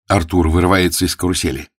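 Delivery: ordinary delivery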